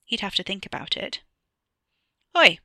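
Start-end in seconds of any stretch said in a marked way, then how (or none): 2.3-2.6 loud, brusque call across the fence; brusque shout. A narrator's reading. Stranger calling sharply at a trespassing boy. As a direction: bark it out to startle the boy. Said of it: "A brusque voice cut the air".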